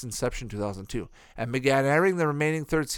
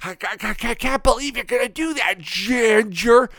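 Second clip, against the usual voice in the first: put-on high, stammering, nasal-free whiny kid; nerdy girl impression; 9 semitones higher, 6 dB louder than usual. Vlogger imitating the gray-haired girl, high and stammering. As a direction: stutter it out like a nervous know-it-all girl. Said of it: "used to always go".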